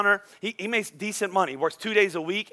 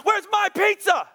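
put-on teen freaking out; 13 semitones higher than usual. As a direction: yell it like an outraged teenage son. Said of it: "He freaks out".

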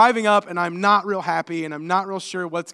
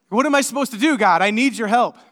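pleading with God, raised and higher; a retold plea, louder and higher; desperate retold prayer; 5 semitones higher, 9 dB louder than usual. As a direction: cry out to God for help. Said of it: "And I start praying".

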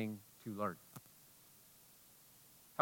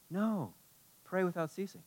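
soft answer; 5 semitones higher, 8 dB quieter than usual. Real, not acted. Preacher drops to soft answer.

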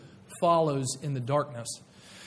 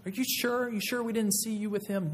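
higher, prying question; suspicious servant girl; 6 semitones higher, 3 dB quieter than usual. Retold or imitated. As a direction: needle him like a doubtful servant girl. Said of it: "She comes back a little later".

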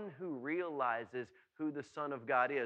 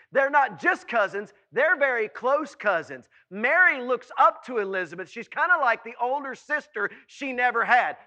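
loud, raised preacher's voice; impassioned preaching; 6 semitones higher, 10 dB louder than usual. Acted. Preacher telling how close Mary and Elizabeth are, impassioned preaching. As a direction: drive each point home forcefully.

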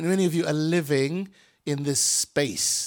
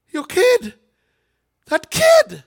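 high, loud anguished cry; rising parent's wail; 8 dB louder than usual. Acted. Parent crying out about a lost son, anguished high wail.

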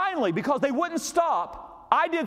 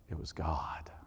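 dramatic whisper; 12 dB quieter, slower than usual. Acted. Preacher revealing to the congregation who got the glory, hushed whisper. Punchline after building suspense.

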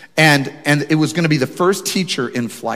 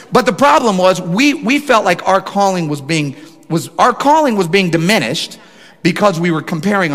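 louder, higher preaching build; 5 semitones higher, 4 dB louder than usual. Acted. Pastor preaching to his church, impassioned. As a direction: drive the point home to the congregation.

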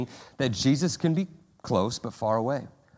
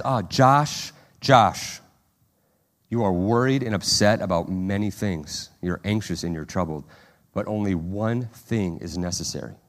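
intimate, tender; 5 semitones lower than usual. Retold or imitated. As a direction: comfort a worried friend by name. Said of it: "he's saying it intimately".

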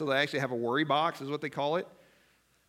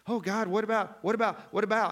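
higher, repeated anxious whine; mock fretting; 6 semitones higher than usual. Preacher mimicking his own anxious prayers, whiny fretting. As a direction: fret in circles, voice raised. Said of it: "I'm just".

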